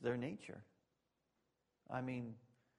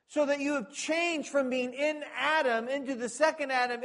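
much higher and louder, impassioned pulpit voice; preaching at full force; 12 semitones higher, 12 dB louder than usual. Acted. Preacher telling believers they are saints now, soaring and loud. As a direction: lift it to the climax and proclaim. Preaching climax on new nature.

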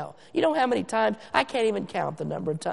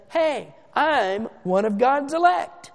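slow, higher, chest-out bragging; mock a proud man announcing himself; puffed-up boast; 5 semitones higher, slower than usual. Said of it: "stick out your check, your chest rather".

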